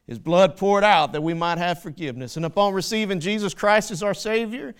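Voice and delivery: loud, rising sermon voice; preaching at full force. Acted. Preacher to congregation, loud full-force preaching. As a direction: drive it home to the congregation.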